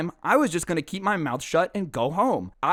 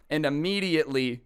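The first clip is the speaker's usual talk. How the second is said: normal talking voice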